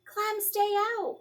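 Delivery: high, loud shout at the dog. A narrator's reading. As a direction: order the dog out sharply. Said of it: "Miranda shouted".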